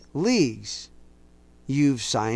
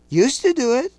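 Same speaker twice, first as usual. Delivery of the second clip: sharp outburst; 11 semitones higher, 6 dB louder than usual. Real, not acted. Preacher's sudden outburst.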